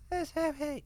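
put-on high breathy whiny ghost girl; breathy Myrtle voice. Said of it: "so, that breathy".

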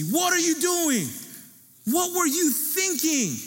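high, slow mock scolding; mad dad. Father scolds his son after the car rolls, slow high mock anger. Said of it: "I remember how mad he was".

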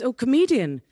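normal talking voice

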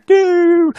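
high, drawn-out "doo" noise; scooter whizz imitation. Co-host describing lake scootering, imitated whizzing noise. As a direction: mimic a zooming scooter with the voice.

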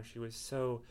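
ordinary delivery

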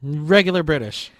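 loud accent cue; announcing cue loudly; called-out cue. Real, not acted. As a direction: shout the next accent. Host calling out next accent.

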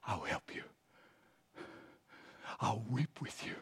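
low, hushed promise of comfort; tender reassurance. Acted. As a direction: drop right down, soft and consoling. God gently comforts the sufferer.

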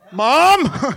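high, loud acted yell; calling out. Father calls Mom as the baby wakes, calling out. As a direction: holler for mom across the house.

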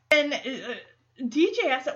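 ordinary delivery

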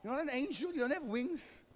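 high, coaxing tempter's voice; tempting Mammon voice. Retold or imitated. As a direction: whisper doubts like a sly tempter, pitched high. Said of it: "Mammon is standing next to me and saying to me".

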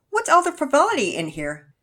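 a cheerful mom walking in. Acted. Narrator voices Mama Peters.

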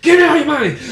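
high, loud stick-up holler; mock robber yell. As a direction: bark it like a fake mugger. Prank robbery shout retold.

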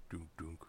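very low, soft heartbeat thud imitation; mock heartbeat. Describing a fading heartbeat to a friend, low thuds.

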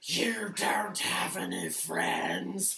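a lightly voiced slow Gollum taunt; Smeagol voice. [name] to himself in the mirror, slow Gollum taunt. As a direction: taunt yourself slowly in the mirror. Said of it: "sound like Smeagol".

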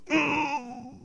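high, soft stifled "mm"; mock pained whimper. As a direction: imitate holding in pain with a small high hum. Imitates character in pain.

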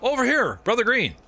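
high call to a friend across the hall. Acted. Half-orc cleric hails Brother Green, high call. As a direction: call them over, pitched high.

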